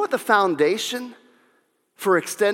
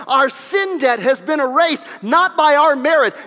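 louder, rising preacher's cadence; fiery preaching; 5 semitones higher, 5 dB louder than usual. Real, not acted. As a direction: build each line like a sermon climax. Preaching at full force.

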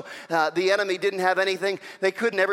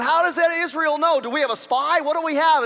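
frustrated enemy, raised and louder; 8 semitones higher, 6 dB louder than usual. Acted. Enemy army puzzling over leaked plans, frustrated. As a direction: demand answers, pitched high. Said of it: "the enemy was getting frustrated".

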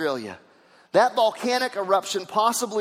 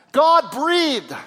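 higher, louder second God breathed; louder proclamation; 10 semitones higher, 9 dB louder than usual. Acted. Preacher repeating God breathed to the church, louder. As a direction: lift it up the second time. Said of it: "repeated, building".

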